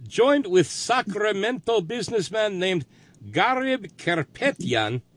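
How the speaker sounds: put-on Russian accent. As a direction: read the news item in a Russian accent. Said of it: "can you do the Russian accent".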